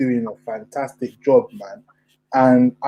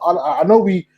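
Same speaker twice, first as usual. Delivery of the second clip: ordinary talk, nothing marked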